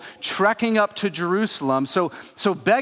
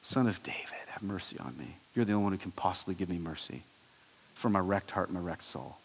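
low, soft, broken plea; hushed pleading cry; 10 semitones lower, 12 dB quieter than usual. Acted. Beggar begs Jesus for mercy, soft broken plea. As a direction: beg with a wrecked heart, quietly. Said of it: "courageous thing to say and scream".

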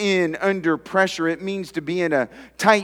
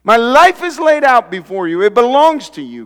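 higher and much louder, crying out to God; passionate prayer; 7 semitones higher, 8 dB louder than usual. Acted. Pastor voicing David's love prayer to God, fervent and loud. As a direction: pour it out like a devoted psalmist. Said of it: "why wouldn't you say to him".

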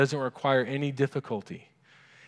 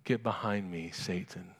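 stern low rebuke; 6 semitones lower, 8 dB quieter than usual. Retold or imitated. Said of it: "And Jesus says what?".

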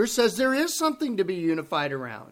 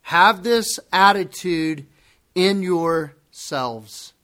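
every word slow and weighted, "Have" leaned on; slow, deliberate scripture read; slow emphatic reading; much slower than usual. Shown as a demonstration. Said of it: "Let's read the first phrase".

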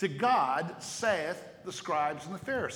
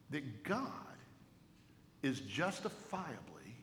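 quiet emphasis; 5 semitones lower, 12 dB quieter than usual. Acted. Repeated key phrase, softer.

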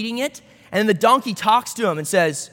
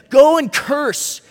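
loud, slow, higher order; acted royal command; 6 semitones higher, 7 dB louder, much slower than usual. King of Moab ordering Balaam, commanding. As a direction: draw out the command, raised and forceful.

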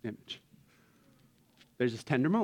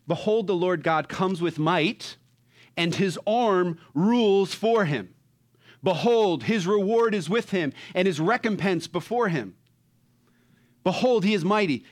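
preaching proclamation; 4 semitones higher, 3 dB louder than usual. Acted. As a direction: proclaim it like a herald. Proclaiming scripture with force.

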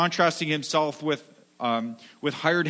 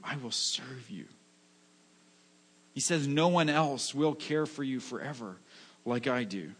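tender promise; 4 dB quieter than usual. Acted. Voicing Jesus's tender promise.

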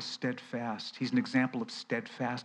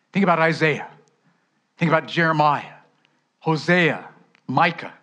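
louder, ringing list of prophets; loud proclamation; 3 semitones higher, 9 dB louder than usual. Acted. Preacher calling out the prophets to the church. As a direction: call each name out strongly.

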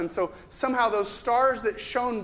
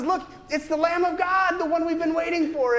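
high, loud and eager, pointing him out; excited announcing; 9 semitones higher, 4 dB louder than usual. Retold or imitated. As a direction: call out the news with eager joy. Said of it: "Do you ever wonder what his tone of voice".

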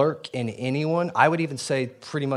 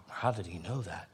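hushed amazement; 5 semitones lower, 13 dB quieter than usual. Retold or imitated. Nathaniel stunned by Jesus.

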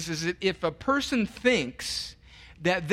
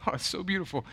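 ordinary delivery

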